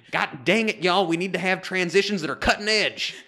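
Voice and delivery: high, hammed-up Texan executive; mock-exasperated Southern boss on the edge of laughing; rally the team in a put-on Southern accent. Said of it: "now we have no more Southern listeners".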